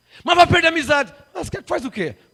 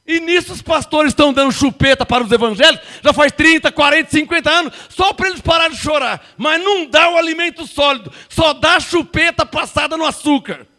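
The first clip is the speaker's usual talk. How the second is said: preaching at full force; 4 semitones higher, 5 dB louder than usual. Real, not acted. Preacher denounces pastors.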